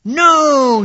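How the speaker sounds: loud, high shouted no; three pigs shout no at the wolf. A narrator's reading. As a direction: bellow it out together. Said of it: "shouted the pigs together".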